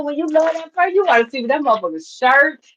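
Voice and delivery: ordinary talk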